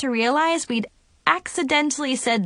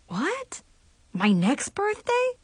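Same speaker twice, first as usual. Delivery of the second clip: high, puzzled question; bewildered; 10 semitones higher, 3 dB quieter, slower than usual. A narrator's reading. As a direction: ask it a little confused. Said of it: "a little bewildered".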